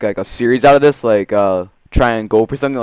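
ordinary talk, nothing marked

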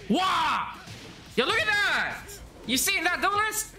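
high, excited outburst; real astonished gamer shout; amazed. Real, not acted. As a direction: call a friend to witness it, high and thrilled. Amazed at a move, calls viewer.

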